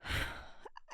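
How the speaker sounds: soft breathy sigh. Acted. Demon gathering itself before explaining to the human, soft sigh. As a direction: let out a quiet sigh.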